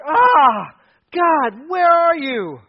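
a loud, high cry of anguish to God. Retold or imitated. Preacher voicing Habakkuk's anguished cry to God, loud and high. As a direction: cry it out like a gut-wrenched plea. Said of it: "this phrase that refers to anguish".

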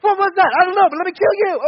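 loud, high mock panic; startled soldiers shouting in confusion; freaked out. Preacher acting out the Midianite army waking up, freaked out. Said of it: "it freaked them out so much".

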